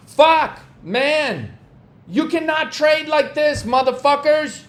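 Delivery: ordinary talk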